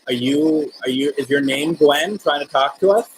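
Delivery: ordinary talk, nothing marked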